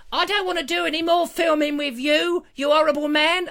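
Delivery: high-pitched whining refusal; mock Julia voice. Julia refusing to film with the host, high mock whine. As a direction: complain in a comic high voice. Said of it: "the lovely Julia, who said".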